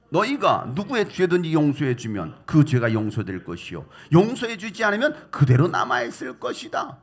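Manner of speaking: loud unison reading of the verse; group scripture reading. Real, not acted. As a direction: read it out together, firmly. Congregation reads scripture aloud together.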